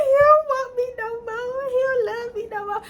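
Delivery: very high comic wail; hammed-up heartbroken whine; mock crying. Girlfriend lamenting that he doesn't love her, mock crying.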